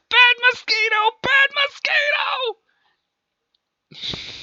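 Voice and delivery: very high, loud squawking scold; frantic scolding. Acted. Man hitting his mosquito leg with newspaper, frantic scolding. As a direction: yell it while swatting at it.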